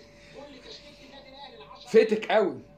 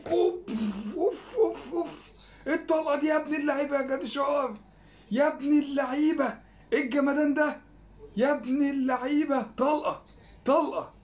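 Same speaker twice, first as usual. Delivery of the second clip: high, thrilled cries of oof and praise; delighted yelps at a stunning strike; excited cheering; 7 semitones higher than usual. Real, not acted. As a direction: cheer the shot like a fan off his seat. Cheering a great shot.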